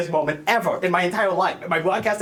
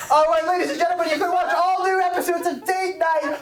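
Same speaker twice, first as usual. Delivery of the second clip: TV announcer sign-off; 9 semitones higher than usual. Acted. Host signs off show.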